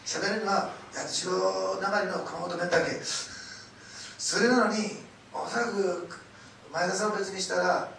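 loud, building sermon delivery; rally the congregation with each line; preaching at full force. Real, not acted.

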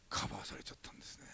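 low unvoiced whisper of relief; whispered awe. Real, not acted. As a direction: breathe it out like a secret. Relief that the tuition was covered.

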